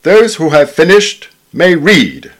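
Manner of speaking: high, loud and slow, ringing out from the dais; a schoolmaster booming an announcement to the pupils at tea; proclaiming schoolmaster. A narrator's reading. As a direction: call it across the dining hall like a headmaster. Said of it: "a voice proclaiming".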